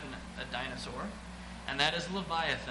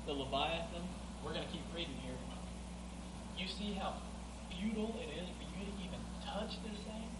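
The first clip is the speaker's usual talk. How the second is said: a softer, hushed voice for God; hushed scripture reading; 9 dB quieter than usual. Acted. Preacher reading God's words quietly to the church. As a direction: draw them in, near a murmur.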